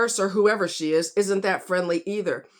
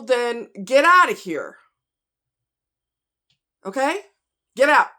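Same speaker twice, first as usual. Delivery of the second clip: furious, louder and higher; angry; 4 semitones higher, 4 dB louder than usual. Real, not acted. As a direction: tell them off hard. Angry tirade about bad doctors.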